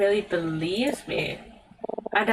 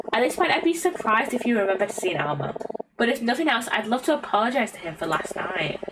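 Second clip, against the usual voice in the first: ordinary talk, nothing marked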